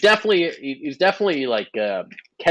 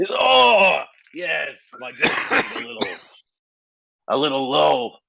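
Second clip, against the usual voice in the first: hammed-up orc; 6 semitones higher than usual. Orc to adventurer about his drink, hammed-up hint. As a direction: act an orc hinting for a refill. GM voices the orc repeating himself.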